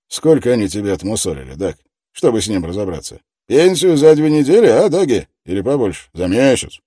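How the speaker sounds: loud, pushy interrogator; tough detective voice. Acted. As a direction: press him hard, loudly. Detective threatens the suspect.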